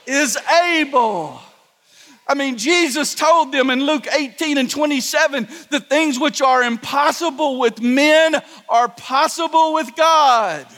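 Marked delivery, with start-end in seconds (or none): 0.0-1.4 shouted declaration. Acted. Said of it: "say it to the problems you're facing". 5.7-10.7 preacher thundering the scripture verse; preaching at full force. Acted. Preaching climax, quoting Jesus.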